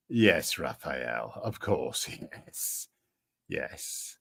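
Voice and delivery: low, soft sarcastic agreement. Real, not acted. Streamer answering a viewer called Raphael, quietly sarcastic. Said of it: "I hope you can hear the sarcasm in my voice".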